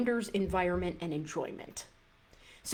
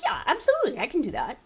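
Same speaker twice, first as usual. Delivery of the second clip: much higher, bright eager yes; 6 semitones higher, 5 dB louder, much faster than usual. Retold or imitated. Client gladly agreeing to the smaller water goal, excited yes. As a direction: jump at it, totally sure you can. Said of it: "And you're like".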